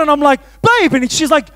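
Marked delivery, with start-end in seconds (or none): 0.6-0.9 alarmed call. Retold or imitated. Son runs to the water.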